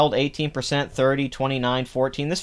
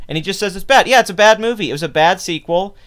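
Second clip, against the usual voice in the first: raised, emphatic rant; 7 semitones higher, 5 dB louder, faster than usual. Real, not acted. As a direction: push it out loud and sure. Ranting about bad sequel.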